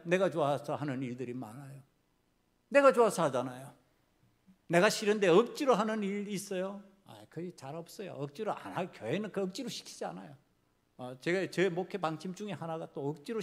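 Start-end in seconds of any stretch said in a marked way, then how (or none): none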